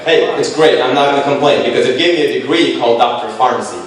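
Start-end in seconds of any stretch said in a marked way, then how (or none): none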